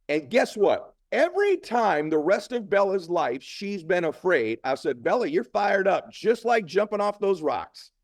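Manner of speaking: high, slow, drawn-out emphasis; impassioned motivational speech. Real, not acted. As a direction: preach the point to a crowd.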